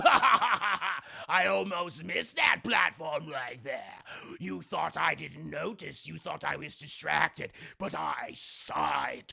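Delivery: slow, drawn-out evil overlord voice; gloating villain. Retold or imitated. Villain who caught the hero sneaking, slow menacing gloat.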